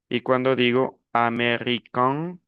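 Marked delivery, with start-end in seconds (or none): 1.1-2.4 "american" rising to a nasal vowel; nasal ending. Shown as a demonstration. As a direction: end "american" through the nose, no full n. ("american" con final nasal)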